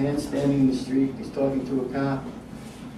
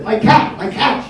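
imitating a frantic woman: "My cat" higher and louder; retold scream; 7 semitones higher, 7 dB louder, slower than usual. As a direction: shout it up high like a panicked neighbour, half-mocking. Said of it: "a woman was screaming at the doorway".

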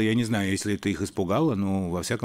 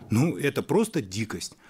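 ordinary talk, nothing marked

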